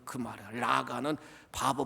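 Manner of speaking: usual speaking voice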